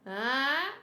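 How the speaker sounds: usual speaking voice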